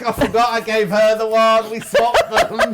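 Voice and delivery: giggling through the words; laughing. Real, not acted. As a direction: laugh while confessing the mix-up. Laughing over swapped sanitizer.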